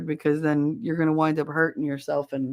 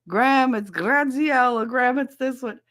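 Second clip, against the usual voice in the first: imitated calling out; 6 semitones higher than usual. Acted calling grandmother to the phone.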